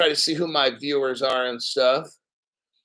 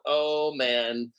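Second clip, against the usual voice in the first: usual speaking voice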